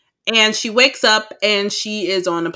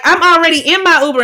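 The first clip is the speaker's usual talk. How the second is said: high, loud and fast mock snap; snippy attitude; 10 semitones higher, 7 dB louder, faster than usual. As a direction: brush off the caller with mock attitude. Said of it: "she has an attitude".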